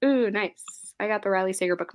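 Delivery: normal talking voice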